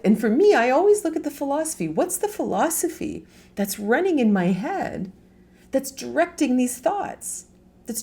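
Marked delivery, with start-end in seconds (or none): none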